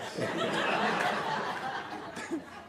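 long high laugh; helpless, wheezy laughter. Real, not acted. Cracking up at the joke.

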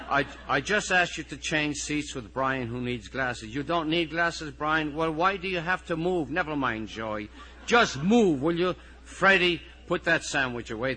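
harried teacher. Acted. Teacher managing a restless class over seating and sandwiches, harried. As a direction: field one pupil's complaint after another.

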